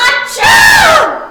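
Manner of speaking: huge high sneeze; loud sneeze. Acted. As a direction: blast out a big sneeze. Gerald sneezes again.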